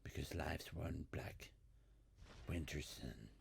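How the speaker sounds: soft, brooding hard-boiled narration; noir detective. Acted. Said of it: "In a film noir style".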